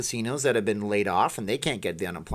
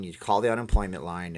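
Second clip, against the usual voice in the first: ordinary talk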